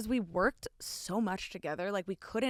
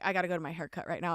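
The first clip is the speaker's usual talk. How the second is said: rattled off fast, a bit lower; hurried quote; 4 semitones lower, much faster than usual. Retold or imitated. Imitating the friend hurrying off to his haircut, fast. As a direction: rush it like he's bolting out the door. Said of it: "He's like".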